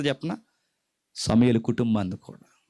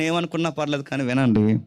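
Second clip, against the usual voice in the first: usual speaking voice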